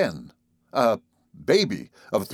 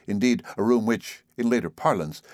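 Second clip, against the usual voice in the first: ordinary delivery, nothing marked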